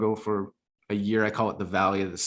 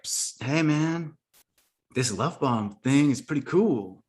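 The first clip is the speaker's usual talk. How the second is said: lightly voiced, higher, sly street-guy murmur; his heart's shady voice; 5 semitones higher than usual. His heart luring him toward the new idea, sly shady murmur. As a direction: tempt like a shady friend in an alley. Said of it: "that's how my heart talks".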